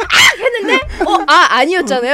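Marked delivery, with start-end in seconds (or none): 0.0-0.4 high, loud mock shriek; retold shriek of fright; startled scream. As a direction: scream at a supposed cat. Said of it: "그래서 제가".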